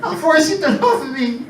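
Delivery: very high, clingy love voice; barbarian. Acted. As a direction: squeal the love like a lame attack. Acting heart's weak attack.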